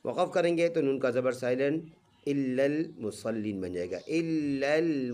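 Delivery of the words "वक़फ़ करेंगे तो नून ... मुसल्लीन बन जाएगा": ordinary talk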